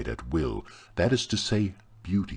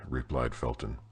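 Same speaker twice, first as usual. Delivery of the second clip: ordinary delivery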